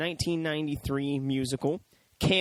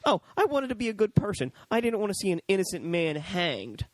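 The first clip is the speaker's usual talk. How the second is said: higher, lightly put-on character voice; imitated kindly reporter; 6 semitones higher than usual. Reporter explaining her kindness instead of love, lightly imitated. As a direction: voice her suggested line, pitched up. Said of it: "No, just fucking".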